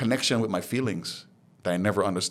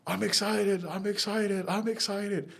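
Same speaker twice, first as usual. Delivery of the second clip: "I'm excited" thrown up much higher, a mock-ecstatic yell; excited scream; 12 semitones higher, 4 dB quieter, slower than usual. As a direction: jump up and shout "I'm excited" at the top of your range. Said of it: "you're gonna jump up and scream".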